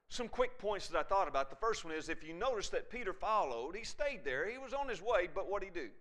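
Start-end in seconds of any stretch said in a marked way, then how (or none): none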